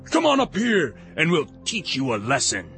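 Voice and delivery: high-pitched call dropping into a threat; taunting shout. A narrator's reading. Philistines calling down to Jonathan, high taunting shout. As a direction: shout down a challenge, starting very high. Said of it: "Then they shouted to Jonathan".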